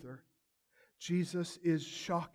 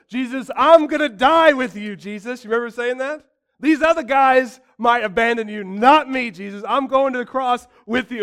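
higher and louder big-talk bravado; mock boastful shout; 7 semitones higher, 16 dB louder than usual. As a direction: play Peter puffing himself up. Peter boasting to Jesus.

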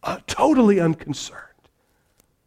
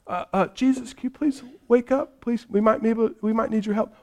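higher, hesitant, polite plea; mock timid request; 4 semitones higher, 4 dB quieter, faster than usual. Disciples politely asking Jesus for help, mock timid. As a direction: tiptoe round waking the teacher, mock meek.

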